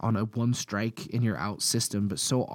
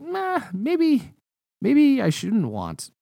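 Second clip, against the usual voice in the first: much higher, squeaky "nah, maybe"; squeaky doubt; 13 semitones higher, 4 dB louder, much slower than usual. Retold or imitated. Preacher doubts his promise to his friend, squeaking up high. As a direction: jump way up in pitch, doubting yourself.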